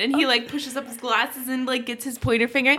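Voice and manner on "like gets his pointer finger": normal talking voice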